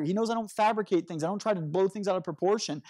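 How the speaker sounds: ordinary talk, nothing marked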